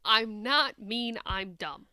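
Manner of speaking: ordinary delivery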